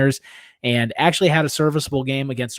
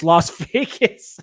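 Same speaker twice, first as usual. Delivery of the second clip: mock slow wrong name; 4 semitones higher, much slower than usual. Mocking his own slip.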